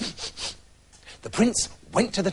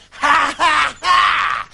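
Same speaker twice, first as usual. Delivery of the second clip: high, loud cackle; the witch cackles in triumph; evil witch cackle; 8 semitones higher, 5 dB louder, much slower than usual. Acted.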